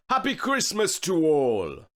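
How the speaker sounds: Saint Nick exclaiming. A narrator's reading. Saint Nick calls goodbye while driving off, jolly exclaim. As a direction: ring it out as a cheery goodbye from the sleigh. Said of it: "I heard him exclaim".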